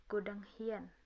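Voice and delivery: "Godang hien" with ng and a separate h; careful spelling pronunciation of "Godang hien"; read as written. Shown as a demonstration. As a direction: sound out "Godang hien" as spelt. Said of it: "cara bacanya dari cara menulisnya".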